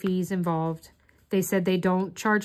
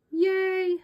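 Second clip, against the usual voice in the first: high little yay; a small delighted cheer, pitched high; happy cheer; 11 semitones higher than usual. Real, not acted. Pleased with herself, a high little cheer of yay. Celebrates fixing her mistake.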